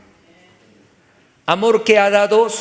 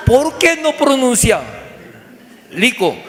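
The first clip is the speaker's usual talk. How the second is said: raised, loud scolding question; mock-angry father, high and loud; exasperated dad; 7 semitones higher, 3 dB louder than usual. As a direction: demand to know why he can't say it. Father scolding son in acted story.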